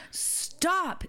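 put-on high, commanding little-kid "Stop"; imitating toddler. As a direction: bark it like a bossy toddler. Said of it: "arms straight out and goes".

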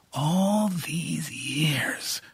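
slow unvoiced whisper; slow stunned whisper. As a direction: let it out on breath alone, slowly. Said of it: "whispered Cora".

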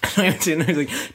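ordinary talk, nothing marked

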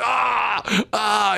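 a short high cry of joy, retold; excited cry. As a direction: let out a happy yelp of relief. Relief after the roulette win.

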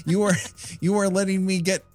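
ordinary talk

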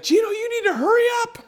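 high falsetto imitation of an impatient wife; mock nagging wife. Wife hurrying husband in the bathroom, mock high nagging. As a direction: hurry him along in a squeaky wife voice. Wife urging him, retold.

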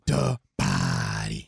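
very low, slow announcer boom; dramatic announcer. Pretend. Cohost booming the handle like an announcer. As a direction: drop it deep like a ring announcer.

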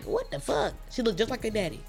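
ordinary delivery

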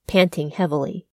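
plain reading voice

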